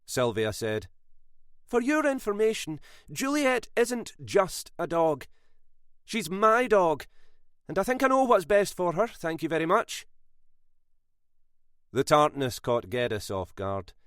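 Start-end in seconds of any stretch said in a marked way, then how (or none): 1.6-10.1 put-on mild old lady giving a tart reply; sharp retort. A narrator's reading. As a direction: put him in his place. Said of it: "had a sharp answer for him".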